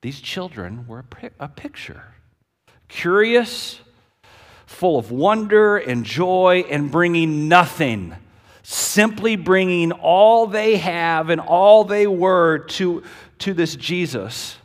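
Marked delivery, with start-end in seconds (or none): 2.9-14.6 loud, slowed proclamation; passionate. Acted. Preacher describing children to congregation, loud and fervent. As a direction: swell with wonder.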